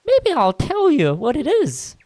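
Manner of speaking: put-on kid voice. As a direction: tease like a smug little kid. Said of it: "No, that's not a kid's voice".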